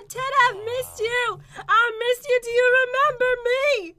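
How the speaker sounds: overdone emotional reunion voice; mock tearful pleading. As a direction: plead melodramatically to be remembered.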